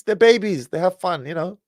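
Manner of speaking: ordinary delivery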